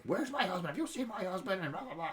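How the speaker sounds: high, frantic woman asking about her husband; frantic wife. Retold or imitated. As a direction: play the shrill-pitched worried wife hunting her husband. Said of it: "and she's like".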